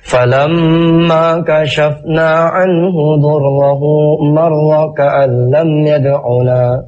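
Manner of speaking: slow, melodic Quranic chanting; Quran recitation. Acted. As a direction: chant the verses slowly and melodically.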